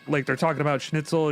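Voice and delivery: usual speaking voice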